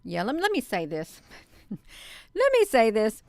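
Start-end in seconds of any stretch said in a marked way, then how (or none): 1.7-3.2 slow, louder, just after a laugh; laugh, then push the words out; amused. Real, not acted. Breaks into laughter before speaking.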